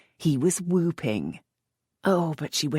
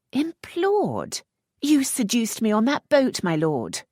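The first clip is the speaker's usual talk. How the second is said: high, louder, affronted; indignant outburst; 10 semitones higher, 4 dB louder than usual. A narrator's reading. Lisette scolding the earl, high and affronted. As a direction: throw his word back at him.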